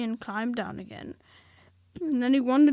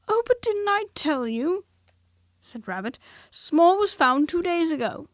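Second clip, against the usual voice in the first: much higher, louder know-it-all; superior Rabbit; 7 semitones higher, 8 dB louder than usual. A narrator's reading. Rabbit telling Eeyore, high smug news. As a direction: deliver the news smugly.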